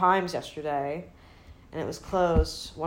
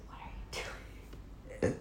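acted appalled whisper; whispered disbelief; 9 dB quieter than usual. Sister watching brother's stand-up, acted whispered dismay. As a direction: whisper it haltingly to yourself.

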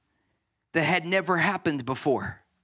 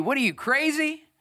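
mocking scoffer; 7 semitones higher than usual. Everyone around mocks believer.

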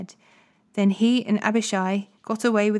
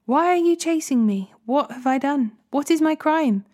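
high-pitched, insistent questions; aggrieved protest; 6 semitones higher than usual. A narrator's reading. David asks the king why he is hunted, protesting. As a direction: plead innocence in rising appeals.

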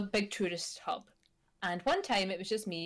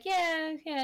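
unenthusiastic; 8 semitones higher than usual. Retold or imitated. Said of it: "you don't sound very enthusiastic".

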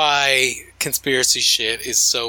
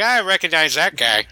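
church watcher voice; 5 semitones higher than usual. Pretend. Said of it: "some guy in the church".

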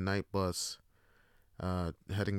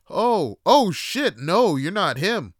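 loud, high, drawn-out dismay; startled realisation; 8 semitones higher, 9 dB louder, slower than usual. Acted. Dealer realising he has the wrong man, loud startled dismay. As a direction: let it dawn slowly and loudly.

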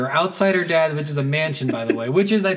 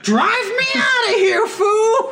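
shrieked, very high laughing shout; mock hysterical shout; 5 dB louder, slower than usual. Gang member to his driver as cars explode, hammed-up shriek. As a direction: top of the voice, comic getaway panic. Third, most escalated demand.

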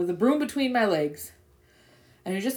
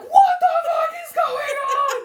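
husband's shout; 6 dB louder than usual. Retold or imitated. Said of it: "And he's like".

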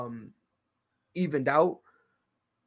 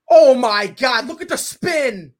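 high loud amazed outburst; excited; 7 semitones higher, 10 dB louder, slower than usual. Real, not acted. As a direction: marvel out loud at the shot. Watching the shot spin.

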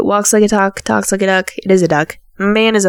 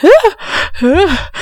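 mock melodramatic wail; 9 semitones higher than usual. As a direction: wail like a soap-opera heroine. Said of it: "she starts sobbing in the hallway".

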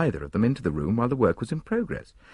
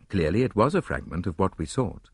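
ordinary delivery, nothing marked

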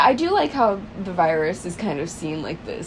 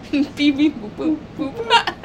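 laughing robot beeps; 5 semitones higher than usual. Pretend.

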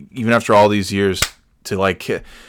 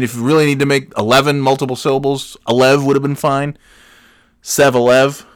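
ordinary delivery

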